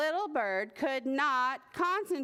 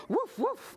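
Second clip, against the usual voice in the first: high double woof; two short, high imitated barks; dog's bark; 10 semitones higher than usual. A narrator's reading. Dog answering the little bird, high barking. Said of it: "answered the dog".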